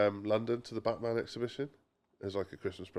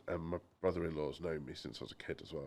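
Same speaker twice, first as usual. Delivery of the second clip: ordinary talk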